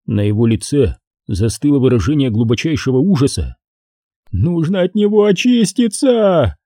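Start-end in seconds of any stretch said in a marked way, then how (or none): 4.3-6.6 slow, high, horrified plea; frantic need to scrub himself clean; panicked cry. A narrator's reading. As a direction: cry it out as if he can't get clean. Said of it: "кричал Хеннесси в панике".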